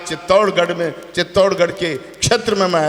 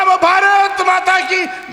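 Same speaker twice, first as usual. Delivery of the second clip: slow, much higher voice, shouted; mocking the MP; 10 semitones higher, 3 dB louder, much slower than usual. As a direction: drag it out high and shrill as a caricatured MP. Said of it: "वो बोला".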